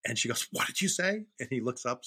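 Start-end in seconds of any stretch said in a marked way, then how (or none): 0.5-1.2 high-pitched, startled mock question; scandalised parental disbelief, acted out; shocked mother. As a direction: voice an appalled mom. Shocked mother voiced in story.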